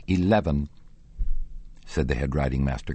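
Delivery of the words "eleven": firm, raised correction; correcting. A narrator's reading. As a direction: put him right with one firm word. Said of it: "correcting him".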